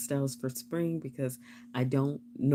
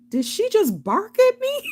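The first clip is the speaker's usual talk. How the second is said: high, squeaky mock outrage; mock outraged squeak; 8 dB louder than usual. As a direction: squeal it like you can't believe it. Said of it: "straight from being articulate to completely".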